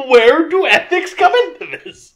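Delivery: high, loud, laughing question; laughing disbelief. Real, not acted.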